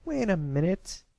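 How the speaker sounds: high, mild objection; slightly protesting. A narrator's reading. Scootaloo halting her friends, mildly protesting. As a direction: lightly push back. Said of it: "in a slightly protesting tone".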